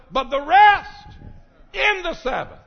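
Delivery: high, loud, shouted preaching; fiery preaching. Acted. Preacher promising rest to weary listeners. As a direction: build each rest into a cry.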